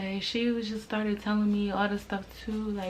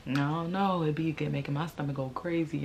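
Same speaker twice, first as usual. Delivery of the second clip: ordinary talk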